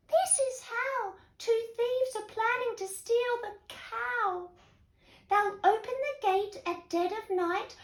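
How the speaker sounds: high-pitched, tiny ladybird reporting the plot; little ladybird voice. A narrator's reading. Ladybird warning the farm animals of thieves, high little voice. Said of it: "told the animals".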